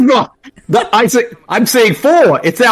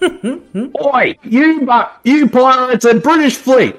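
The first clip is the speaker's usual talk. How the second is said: Russell Crowe impression; 10 semitones higher, slower than usual. Podcaster doing a silly Russell Crowe threatening pirates, mock tough. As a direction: play Russell Crowe picking a fight, badly. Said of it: "That's my best Russell Crowe impression".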